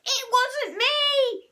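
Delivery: high, loud, slow drawn-out scream. A narrator's reading. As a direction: protest your innocence at full voice. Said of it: "screamed Henry".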